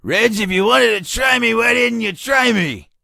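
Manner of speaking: high, loud retold yell. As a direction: holler it out, stretching the end. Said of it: "Stu yells".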